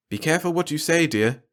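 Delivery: a mother sighing happily over the couple; Marge's voice. Acted. A wife to her husband after the guests leave, fondly.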